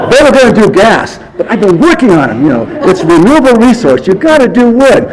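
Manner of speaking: high, eager, excited quote; imitating a friend's excited high voice; excited retelling. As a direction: gush with proud enthusiasm, pitched well up. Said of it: "so excited. He goes".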